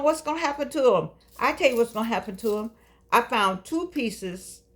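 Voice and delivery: ordinary delivery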